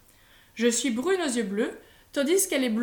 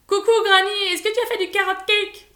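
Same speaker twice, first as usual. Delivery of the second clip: much higher, louder eager greeting; excited boy; 8 semitones higher, 8 dB louder than usual. A narrator's reading. Grandson rushing to Granny asking for cake, excited. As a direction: burst in bouncing with excitement.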